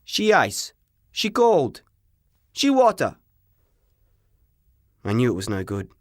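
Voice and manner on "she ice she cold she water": put-on rising, broken-English Italian accent; Italian shopkeeper telling the boy the ice must go. A narrator's reading. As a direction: shrug it off in a heavy Italian accent. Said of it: "he said".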